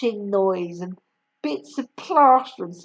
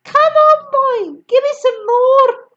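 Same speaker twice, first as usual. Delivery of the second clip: high, loud greedy yell; Grandma yelling at George for more; yelled demand; 12 semitones higher, 9 dB louder than usual. Acted. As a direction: bellow for more. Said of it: "she yelled".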